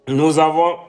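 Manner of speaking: ordinary talk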